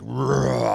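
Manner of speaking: short throaty growl of strength; imitated beastly roar. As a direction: growl to show off feeling beefy. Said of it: "I'm kind of getting, like".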